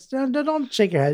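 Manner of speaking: high-pitched imitated objection; flustered protest. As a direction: voice jumps up in mock protest. Acting out his flustered objection.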